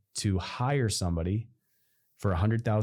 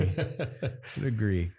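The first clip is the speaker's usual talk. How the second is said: laughing; 2 semitones higher, slower than usual. Real, not acted.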